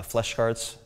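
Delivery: ordinary delivery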